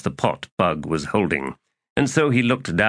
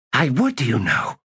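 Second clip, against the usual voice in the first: put-on vehement, higher-pitched girl; sudden vehemence; 5 semitones higher than usual. A narrator's reading. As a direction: burst out with sudden conviction. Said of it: "she said with sudden vehemence".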